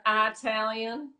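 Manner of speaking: slow Southern "Italian" with a hard long I, like "Eye-talian"; Southern drawl. Retold or imitated. Imitating the Carolina friend's slow Southern accent. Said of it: "she gives that southern accent".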